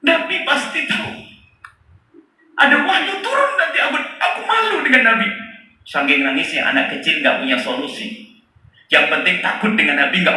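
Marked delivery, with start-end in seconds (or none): 0.0-5.3 put-on high, tearful pleading voice of a weeping boy; crying boy. Boy crying to God in shame, high and tearful. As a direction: sob out the shame, voice pitched high. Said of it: "langsung nangis dia".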